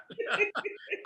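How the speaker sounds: short high laugh. Real, not acted. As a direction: laugh at your own dare. Laughs at his dare.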